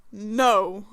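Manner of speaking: low, restrained NO; angry no. Acted. Player refusing to accept Lucifer, angry no. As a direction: drop NO low and firm, mock angry. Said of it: "I'm angry no".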